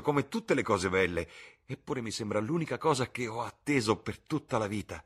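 bursting with happy news; joyful young Dorian. Acted. Young Dorian greeting his friends with his engagement news, overjoyed.

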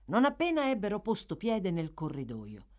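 usual speaking voice

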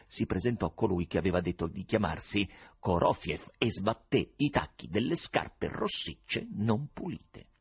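plain reading voice

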